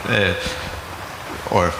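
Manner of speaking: normal talking voice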